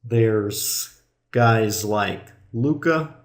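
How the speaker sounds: usual speaking voice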